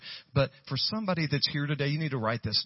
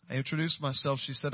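ordinary talk, nothing marked

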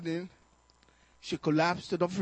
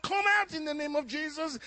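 shouting out; 10 semitones higher than usual. Retold or imitated. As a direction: command the demon out at full voice. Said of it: "So I started calling out".

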